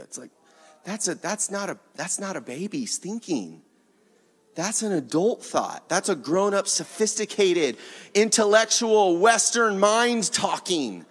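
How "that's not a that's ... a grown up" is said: ordinary delivery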